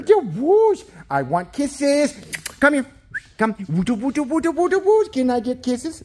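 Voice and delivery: way up high, cooing for kisses. Real, not acted. Owner begging her puppy for kisses, high cooing voice. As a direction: coax the puppy in a squeaky voice.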